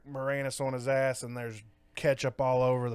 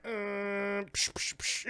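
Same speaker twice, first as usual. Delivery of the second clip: imitated gunshots; 4 semitones higher, 8 dB quieter, much slower than usual. Imitating gunfire sounds.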